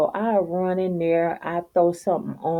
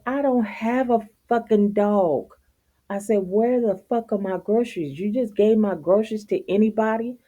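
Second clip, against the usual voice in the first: angry retort; 6 semitones higher than usual. Retold or imitated.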